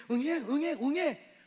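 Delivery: high mock baby wail; hammed-up baby crying; imitated baby cry. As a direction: cry out like an infant.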